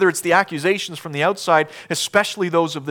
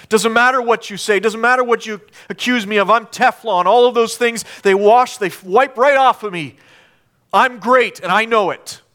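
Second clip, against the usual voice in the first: loud, higher show of bravado; hammed-up self-assurance; boastful; 8 semitones higher, 7 dB louder than usual. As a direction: brag as if nothing can touch you. Mocking a boastful self-confident answer.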